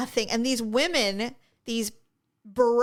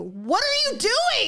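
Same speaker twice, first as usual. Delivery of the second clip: high, slow, drawn-out disbelief; exasperated cry; 11 semitones higher, slower than usual. Retold or imitated. Host yelling at a yawning contestant on TV, high slow disbelief. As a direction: stretch it out, pleading in disbelief.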